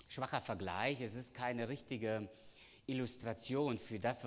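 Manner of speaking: ordinary talk, nothing marked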